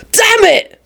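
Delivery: high yelp of a curse; pained curse. A narrator's reading. William bitten by the dog, yelping in pain. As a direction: cry out as if just bitten.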